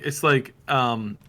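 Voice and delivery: usual speaking voice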